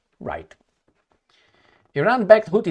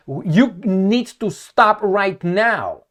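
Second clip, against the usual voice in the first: stern order; 4 semitones higher, 4 dB louder, much slower than usual. Retold or imitated. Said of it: "He said".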